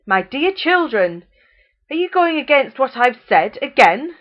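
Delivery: loud, rising angry threat; angry mother. A narrator's reading. Mother threatening full children to eat every crumb, angry. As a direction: menace them to eat. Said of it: "she became angry".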